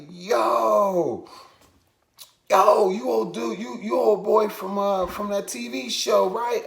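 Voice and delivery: high-pitched starstruck fan call; excited recognition. Retold or imitated. Stranger spots him from a TV show, excited recognition. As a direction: imitate a fan spotting someone off TV.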